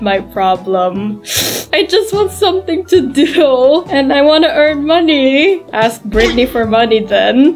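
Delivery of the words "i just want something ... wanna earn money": high whiny plea; pleading Cherry voice. Acted. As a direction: beg like a sulky kid. Cherry begging for money.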